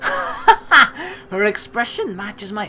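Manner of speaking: reading through a laugh. Real, not acted. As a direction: giggle while narrating.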